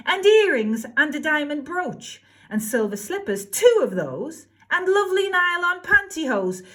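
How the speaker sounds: high, loud demanding shout; shouting demands. Acted. Cindy shouting her demands at the fairy. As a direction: bawl out every want. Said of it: "and shouted".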